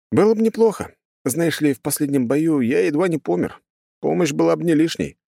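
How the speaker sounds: high-pitched, casual hero voice; hint for help in a high voice; angling hero. Acted. Hero angles for a reward, casual.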